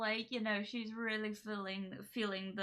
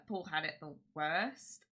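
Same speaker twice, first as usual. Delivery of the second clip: normal talking voice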